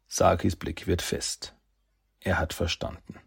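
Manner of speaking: plain reading voice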